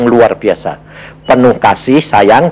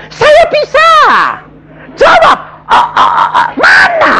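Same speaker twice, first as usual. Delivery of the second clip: shout. Retold or imitated. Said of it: "Saya bilang".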